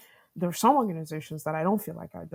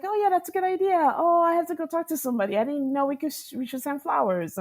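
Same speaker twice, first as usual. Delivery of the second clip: mocking the clueless boss, much higher and fast; a parody of a manager who never thought of it, pitched way up; mock condescending; 9 semitones higher, 5 dB louder than usual. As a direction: ham up his oblivious, patronizing cheer. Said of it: "sort of condescending".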